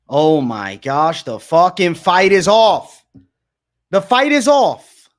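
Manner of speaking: loud, slow yell; loud slow disbelieving yell; shouting in disbelief. Real, not acted. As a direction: bellow it out in real shock. Yelling the fight is off.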